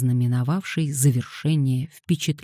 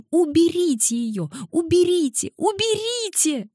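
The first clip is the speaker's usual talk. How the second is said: higher and higher panicked shrieks; shrieking. Acted. Little girl shrieking in panic at the boys, rising higher. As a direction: shriek to get the fly off. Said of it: "верещала она".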